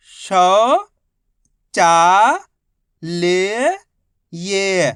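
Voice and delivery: each letter held long and loud, a pause after each; syllable by syllable. Shown as a demonstration. Teacher showing learners each letter's sound, syllable by syllable. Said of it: "एक अक्षर का उच्चारण किया जाता है".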